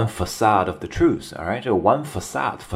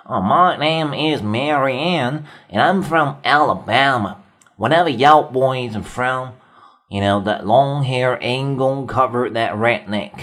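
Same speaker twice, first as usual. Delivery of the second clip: slow, drawled Alabama twang, pitched up; 5 semitones higher, slower than usual. Retold or imitated. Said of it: "南方的口音， 而且会很重啊".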